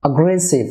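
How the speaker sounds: "অ্যাগ্রেসিভ" opening on a weak "a"; correct pronunciation. Shown as a demonstration. Teacher models the correction, "অ্যাগ্রেসিভ" as uh-GRE-siv. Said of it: "এ এর উচ্চারণ হবে আ".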